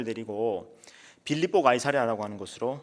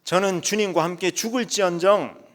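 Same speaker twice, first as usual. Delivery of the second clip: higher, louder cry of loyalty; shouting vow; 7 semitones higher, 7 dB louder than usual. Acted. Peter shouting his vow to Jesus, loud. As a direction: shout it out with full conviction. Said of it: "베드로가 또 소리칩니다".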